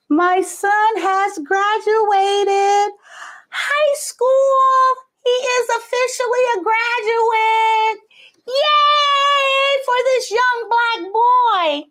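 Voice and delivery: high, drawn-out celebratory cheer; stretched-out proud "yay"; proud announcement. Real, not acted. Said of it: "I wanted to announce".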